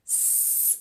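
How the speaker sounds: a soft unvoiced "S" hiss; just breathe the "S" out like a hiss; hissed S sound. Shown as a demonstration. Teacher showing kids the S sound, hissing softly. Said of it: "o som do S é esse".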